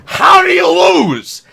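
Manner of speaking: high, slow, loud outrage; exasperated yell. Real, not acted. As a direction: yell it in disbelief. Raging at tic-tac-toe loss.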